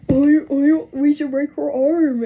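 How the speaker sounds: loud, slow oafish dad voice; mock baseball dad. Baseball dad suggesting a fix, loud mock drawl. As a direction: imitate a dad loudly drawling a bad idea.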